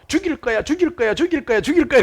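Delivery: high repeated cries, imitating an echo; multiplying echo. Echo returns the boy's curse tenfold, high imitated repeats. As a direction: bounce it back over and over. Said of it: "메아리가 한 열 배로".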